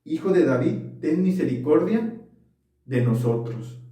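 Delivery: ordinary talk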